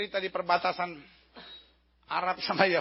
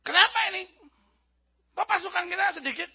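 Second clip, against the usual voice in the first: high, loud questioning officer; hammed-up indignant commander; alarmed general; 10 semitones higher, 4 dB louder than usual. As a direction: demand an explanation from the troops. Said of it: "Jenderal Arabnya bilang".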